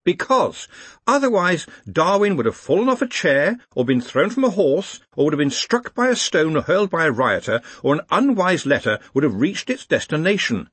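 high, insistent explanation; high-pitched academic explaining; earnest young organiser. Acted. Ponder explains over clamour.

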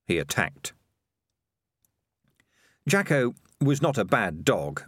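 usual speaking voice

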